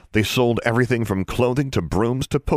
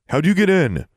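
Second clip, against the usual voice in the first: higher, louder sharp question; demanding; 9 semitones higher, 4 dB louder than usual. A narrator's reading. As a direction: demand an answer. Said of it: "Vance demanded".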